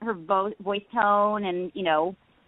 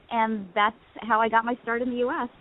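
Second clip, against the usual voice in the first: ordinary delivery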